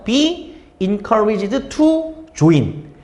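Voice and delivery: Be encouraged to join stretched out word by word; very slow model reading. Shown as a demonstration. Teacher modelling Be encouraged to join for students, very slow. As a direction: drag out Be encouraged to join like dictation. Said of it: "이거 기억나죠?".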